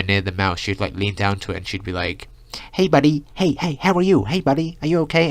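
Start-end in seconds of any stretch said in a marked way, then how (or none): 2.7-5.3 put-on high, grating American girl's voice; American accent. Girl leaning down to the mouse, high cutesy American accent. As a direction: imitate an annoying American accent, pitched way up. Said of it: "in her, like, American accent".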